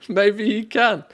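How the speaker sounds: high, loud words through laughter; laughing. Real, not acted. Streamer laughs through a reply about the bot's accent. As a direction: talk while still cracking up.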